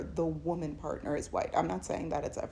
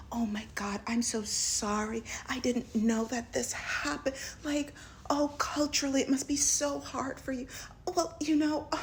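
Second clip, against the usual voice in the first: starts whispered, then much higher and sugary; gushing sympathy; 7 semitones higher than usual. Retold or imitated. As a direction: pours out pity, high and breathless. Woman gushing over his story.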